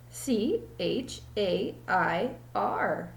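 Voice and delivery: slow letter by letter; spelling it out. Acted. Allison spells the word for the teacher, slow and deliberate. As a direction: spell each letter slowly. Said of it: "said Allison".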